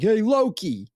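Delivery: high-pitched New York policeman voice; New York accent. Retold or imitated. Policeman telling a dog walker off, in a high New York accent. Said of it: "like, a New York accent".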